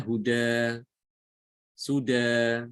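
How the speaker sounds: huda said slowly, ending on an open a; demo pronunciation. Shown as a demonstration. Teacher reads hudan as huda at the stop. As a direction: huda read with no n at the pause. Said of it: "Jadi bacanya".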